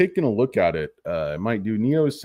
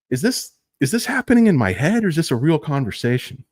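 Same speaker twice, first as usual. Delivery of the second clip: incredulous; 6 semitones higher, 4 dB louder than usual. Pretend. Speaker questioning his sanity.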